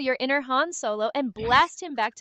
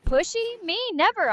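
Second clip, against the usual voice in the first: mock indignant; 7 semitones higher, 3 dB louder than usual. Mock offended denial.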